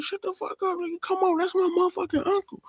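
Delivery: high-pitched, rapid comeback in an acted row; mock defensive retort. Tariq arguing back at Kane over his uncle, high mock defensiveness.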